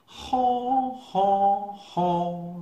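high, loud braying haw haw haw; giraffe laughing. Acted. Giraffe laughing at the muddy egret, loud high brays. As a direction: bray it out, mocking the egret. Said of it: "laughed giraffe".

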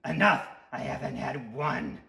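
gruff little tooth character; cranky cartoon tooth; raspy Tooth voice.

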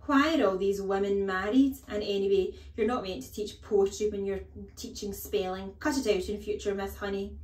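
loud, indignant headmistress voice; overbearing headmistress, scolding. Acted. Headmistress scolding teacher over poetry, loud and indignant. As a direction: berate a junior teacher loudly.

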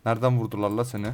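normal talking voice

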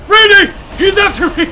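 loud panicked shout; panicked yell. Real, not acted. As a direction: scream it while fleeing. Chased by enemy.

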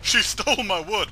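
loud, laughing complaint; outraged complaint. Pretend. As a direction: cry out to the officer. Acted complaint to officer.

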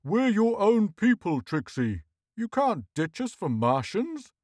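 high, pleading outcry; urgent high-voiced appeal; crying out. A narrator's reading. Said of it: "Horseface cried".

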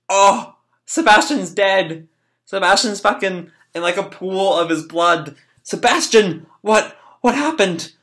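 high, dismayed outburst; acted shock. Boy finds Sebastian dead in blood, acted shock. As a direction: voice jumps up in mock alarm.

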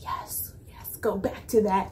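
hushed, breath-only whisper of approval; whispered cheer. Retold or imitated. Fan's own reaction, quoted.

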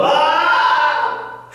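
short high, loud "Ah!" of shock; mock scream. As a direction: imitate a mother's shocked scream. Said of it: "She started screaming".

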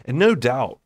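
usual speaking voice

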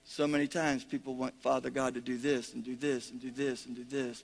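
ordinary talk

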